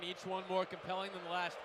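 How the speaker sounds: usual speaking voice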